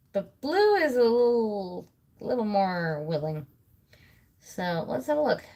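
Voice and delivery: usual speaking voice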